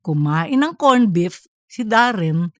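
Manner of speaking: ordinary delivery, nothing marked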